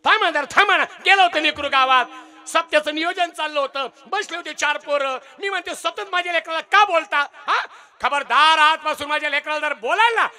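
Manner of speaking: a lightly voiced mother defending her son; mother scolding.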